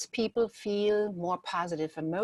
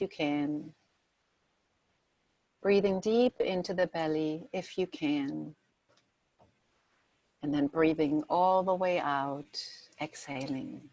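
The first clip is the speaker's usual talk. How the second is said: guided meditation. Acted. Leads a guided relaxation exercise.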